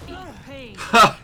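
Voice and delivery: slurred tipsy brewer; drunk voice. Acted. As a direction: slur it like a merry barkeep. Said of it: "Um, that's my drunk".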